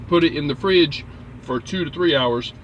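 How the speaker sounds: ordinary talk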